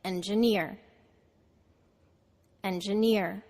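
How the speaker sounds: engineer with NEER stressed, middle syllable reduced; stress on third syllable. Shown as a demonstration. Teacher models a word for students to repeat, final stress. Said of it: "the third syllable is stressed".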